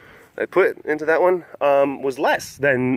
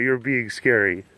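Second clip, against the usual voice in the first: low imitated voice; 4 semitones lower, 4 dB quieter, slower than usual. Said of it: "did you not like it when I was talking like that".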